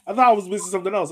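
normal talking voice

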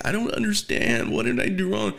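high-pitched whiny imitation of a crying girl; mock crying. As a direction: plead like a tearful drunk, hammed up. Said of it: "she's, like, crying".

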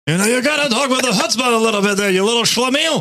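a hint of a scolding Yiddish uncle.

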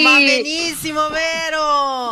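loud shout toward the phone. Real, not acted. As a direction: call out loudly so the phone hears.

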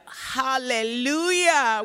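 slow, loud, high "Hallelujah"; a drawn-out, church-style cheer; joyful hallelujah. Retold or imitated. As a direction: stretch it out in celebration. Celebrating good news.

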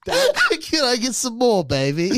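slow begging orphan turned cheeky swinger; Oliver Twist mixed with Austin Powers. Acted. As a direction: start high and pleading, drop on the last word. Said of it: "Oliver Twist mixed with Austin Powers".

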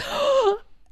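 sharp, high acted gasp; anxious gasp. Kids in the theater in suspense, anxious gasp. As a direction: gasp like a nervous kid. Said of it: "like, anxiety, like".

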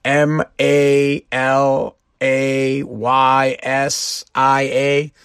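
slow, letter by letter spelling. Shown as a demonstration. Spelling out Malaysia slowly for viewers. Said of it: "I don't even know how to say it".

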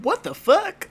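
high-pitched, laughing bafflement; hammed-up baffled parent; mock disbelief. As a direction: squeak it out, appalled and cracking up. Baffled parent reacting to child's accent.

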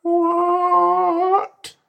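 long, very high squealed word; mock amazement. Friend reacting to news of never-full man, amazed squeal. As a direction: squeal it, drawn out in mock disbelief. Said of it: "another bit of you would go".

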